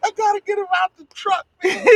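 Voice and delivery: high, fast, half-sobbing panic; panicked crying laugh. Real, not acted. Bug inside his truck.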